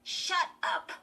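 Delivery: high, loud, irritated command; snappish. A narrator's reading. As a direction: snap at the dummy. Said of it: "Chris snapped to the dummy".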